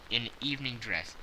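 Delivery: ordinary delivery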